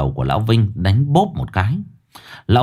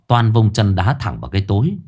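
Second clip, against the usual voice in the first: ordinary delivery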